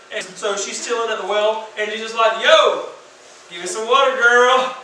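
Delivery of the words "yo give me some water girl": loud casual street-slang greeting; hammed-up cool-guy ask; joking slang voice. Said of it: "He might not have said it like that".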